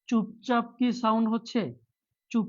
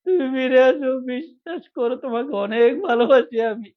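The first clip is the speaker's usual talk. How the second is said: hammed-up crying plea, higher and louder; mock tearful begging; 5 semitones higher, 5 dB louder than usual. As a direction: beg like a drama king, voice raised in mock sobs. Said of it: "drama king sir".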